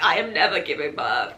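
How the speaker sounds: suddenly low, loud declaration; emphatic vow. Retold or imitated. Patient swearing off childbirth, low emphatic vow. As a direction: drop low and declare it forcefully. Retold vow, acted in pain.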